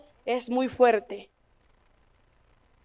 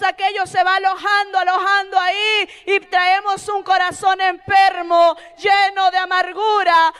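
higher, swelling to a shout; fervent preaching; 6 semitones higher, 3 dB louder than usual. Acted. Preacher warning the church about daily sin, rising to a shout. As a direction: hammer the warning home.

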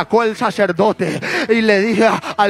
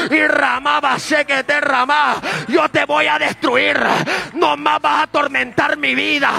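higher, shouted battle-cry preaching; preaching at full force; 4 semitones higher than usual. Acted. Preacher urging the church to fight back, at full force. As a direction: declare victory as a fiery preacher.

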